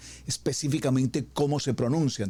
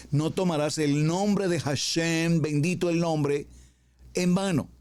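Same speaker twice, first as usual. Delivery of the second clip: solemn commandment; 4 semitones higher than usual. Acted. Preacher proclaiming the third commandment, solemn.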